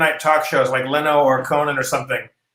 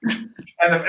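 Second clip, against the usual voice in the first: usual speaking voice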